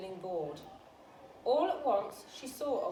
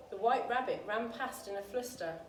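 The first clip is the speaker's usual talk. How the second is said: plain reading voice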